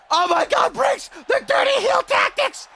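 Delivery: high-pitched cry of outrage; scandalised ringside commentator, voice shooting up; shocked commentary shout. Real, not acted. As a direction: yell in shock at the cheating.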